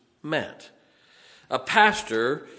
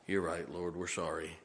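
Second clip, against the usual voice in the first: much softer and lower, sheepish; ashamed apology; 8 semitones lower, 12 dB quieter than usual. Acted. Ashamed disciples apologizing to Jesus, soft and sheepish. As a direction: hang your head and say sorry.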